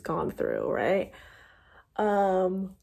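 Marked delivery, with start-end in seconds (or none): 2.0-2.7 mid-yawn. Real, not acted. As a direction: yawn through the word. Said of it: "sorry, had to yawn".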